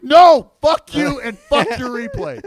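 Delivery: loud, high, furious retort; angry yell. Retold or imitated. Announcer snapping at his producer about the replay, loud angry yell. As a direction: blow up at the producer. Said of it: "And I'm like".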